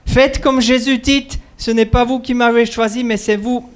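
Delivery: ordinary talk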